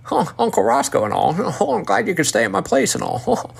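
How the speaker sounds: put-on high, laughing old country uncle voice; impersonating Uncle Roscoe. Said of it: "impersonate him".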